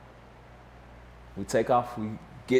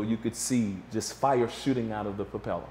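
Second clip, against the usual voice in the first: normal talking voice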